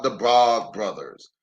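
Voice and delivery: plain reading voice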